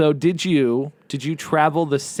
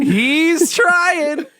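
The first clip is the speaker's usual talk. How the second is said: squeaky high cartoon voice, louder; 14 semitones higher, 4 dB louder than usual. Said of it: "Mr. Meeseeks impression".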